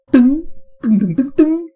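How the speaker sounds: high, loud, ringing ding sounds; high bright dings. Shown as a demonstration. Streamer imitating a bright focused drum ring, high and loud. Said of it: "I think ding sounds focused, strong and bright also".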